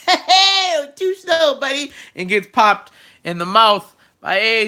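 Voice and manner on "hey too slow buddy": high, laughing playground tease; childish taunt. Retold or imitated. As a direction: taunt like a kid on the playground, giggling. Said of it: "He does the old kindergarten".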